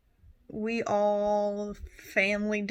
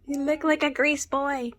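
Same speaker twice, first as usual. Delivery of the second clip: teasing; 4 semitones higher, 5 dB louder than usual. Real, not acted. Teasing friend about her hair.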